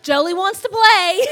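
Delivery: loud, high, amused call; excited announcement. Retold or imitated. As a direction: call out gleefully for the dog. Voicing excited reaction to dog's whining.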